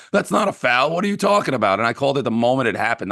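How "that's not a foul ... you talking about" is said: high, scoffing mock of the rival fans. Fan mocks the Sixers fans around him, high and scoffing. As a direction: sneer it back like a know-it-all fan. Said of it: "they're like".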